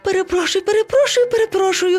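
very high, pleading little voice; flustered apologizing. A narrator's reading. Monkey apologizing to all the animals, flustered. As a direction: tumble out repeated apologies.

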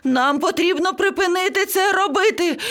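high, frantic parrot voice; panicked yelling. A narrator's reading. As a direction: plead in a squawky high panic. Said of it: "зарепетував папуга". (панічно репетує високим голосом)

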